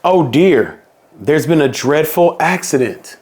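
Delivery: ordinary delivery, nothing marked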